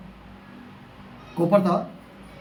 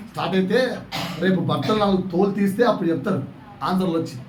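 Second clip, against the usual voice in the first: usual speaking voice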